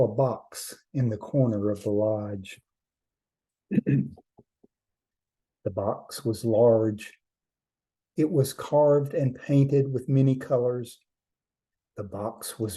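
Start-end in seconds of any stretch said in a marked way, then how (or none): none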